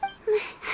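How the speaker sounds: a sad, breathy sigh before answering; heavy sigh. Real, not acted. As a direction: let out a weary sigh. Reacting to "unfair.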